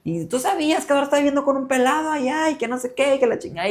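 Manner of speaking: put-on a drunk grandfather's tearful reproach; mock drunk grandpa. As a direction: throw the blame at her, tearful and drunk. Grandpa's drunk accusation, retold.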